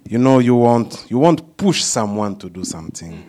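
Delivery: ordinary delivery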